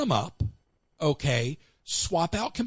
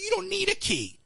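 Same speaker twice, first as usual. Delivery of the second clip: mock incredulous. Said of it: "I said".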